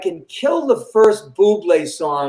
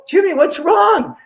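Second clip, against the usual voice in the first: high, raised-voice worried question; alarmed concern; 9 semitones higher than usual. Retold or imitated. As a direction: imitate worried girls calling out in alarm. Worried girls seeing him upset.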